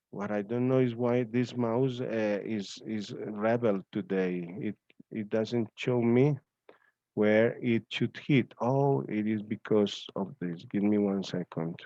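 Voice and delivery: ordinary delivery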